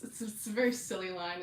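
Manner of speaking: ordinary talk, nothing marked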